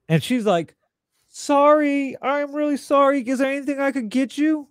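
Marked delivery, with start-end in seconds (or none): 1.3-4.7 high-pitched mock apology; imitated apologetic woman. Man imitating the woman who punched him, high-pitched apology. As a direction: play her pleading offer, pitched well up.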